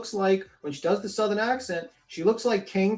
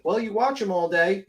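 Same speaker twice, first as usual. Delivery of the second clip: ordinary delivery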